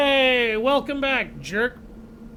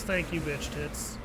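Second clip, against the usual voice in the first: softer, slow, offhand imitation of "Thank you, bitch tits."; very casual; 6 semitones lower, 11 dB quieter than usual. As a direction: toss it off like it's nothing, lower and softer. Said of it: "And Patton just very casually goes".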